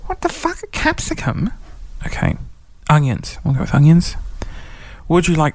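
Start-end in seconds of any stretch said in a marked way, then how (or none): 0.1-1.5 high-pitched outraged squeak; incredulous, voice jumping up; shocked disbelief. Real, not acted. Outraged at quiz sandwich topping.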